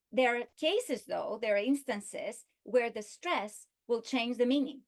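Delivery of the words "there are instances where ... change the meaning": ordinary talk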